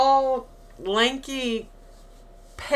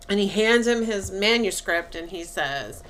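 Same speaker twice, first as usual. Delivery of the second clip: ordinary delivery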